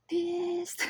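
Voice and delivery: high, drawn-out single word; emphatic punchline. Real, not acted. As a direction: stretch it out high for comic emphasis. Stressing how angry parents got.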